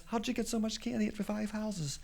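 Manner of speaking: high, soft little question; wondering child, hushed and high; meek kid. Acted. Lance meekly questions teenager.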